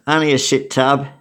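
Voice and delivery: plain reading voice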